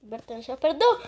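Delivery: usual speaking voice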